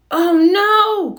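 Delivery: a masked hero exposed, crying out in dismay; alarmed cry. A narrator's reading. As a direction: gasp it out in shock. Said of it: "cried Spidey".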